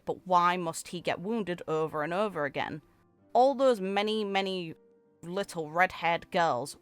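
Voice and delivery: ordinary delivery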